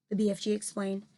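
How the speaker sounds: usual speaking voice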